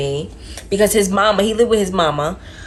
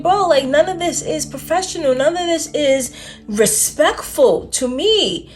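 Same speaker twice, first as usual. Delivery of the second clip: higher, indignant protest; calling them out, higher; retold confrontation; 7 semitones higher than usual. Recalling confronting his best friend, higher indignant protest. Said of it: "And I was like".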